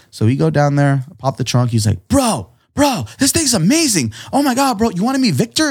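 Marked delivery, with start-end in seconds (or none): 2.1-5.7 high, excited mock gush; amazed gushing. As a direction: gush like a wowed fan. Guy amazed by painting.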